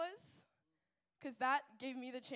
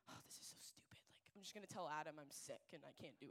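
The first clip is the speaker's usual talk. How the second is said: whispered despair; 7 semitones lower, 12 dB quieter, much faster than usual. Retold or imitated. Despairing late-night inner thought.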